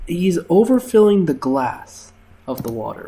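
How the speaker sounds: normal talking voice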